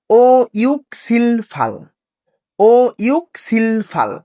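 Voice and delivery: slow, spaced ইউক সিল ফাল; slow drill for the class to copy; slow repeat-after-me. Shown as a demonstration. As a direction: give students time to echo. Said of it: "আমার সাথে সাথে বলবেন।".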